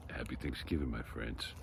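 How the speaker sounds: whispered goodbye. Real, not acted.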